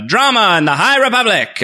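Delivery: booming trailer announcer, pitched high. Retold or imitated. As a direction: announce it like a show intro. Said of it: "the announcer voice at the start of each episode".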